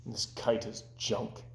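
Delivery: slow, soft and low; dejected. Acted. Toad telling Frog the kite is junk, soft and low. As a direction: mumble it sadly. Toad glumly repeats robins.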